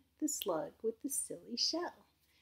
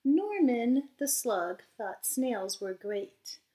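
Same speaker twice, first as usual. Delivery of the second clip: lively storybook read-aloud voice; reading to children; 5 semitones higher, 7 dB louder, slower than usual. A narrator's reading. Teacher reading a storybook aloud to her class, lively. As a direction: read it bright and animated for little listeners. Reads picture book to children.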